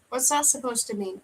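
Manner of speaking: ordinary delivery, nothing marked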